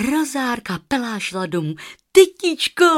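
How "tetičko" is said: much higher, loud call. Acted. Rozárka runs home calling her aunt, high loud call. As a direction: shout for your aunt running home.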